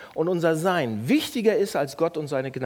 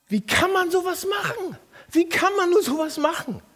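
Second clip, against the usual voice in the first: high, outraged disbelief; shocked and furious, voice jumping up; indignant outburst; 13 semitones higher than usual. Retold or imitated. Pastor recalling his outrage at a man. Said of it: "Und ich hab gesagt".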